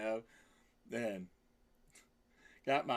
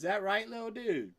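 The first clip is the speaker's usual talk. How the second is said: pet talk; 7 semitones higher, 6 dB louder than usual. Real, not acted. Baby talk to the cat.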